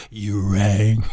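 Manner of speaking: deep, slow butler groan; deadpan like a creepy butler; Lurch. Retold or imitated. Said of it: "you can hear Lurch".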